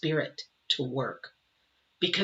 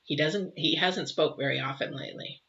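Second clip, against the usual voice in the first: ordinary talk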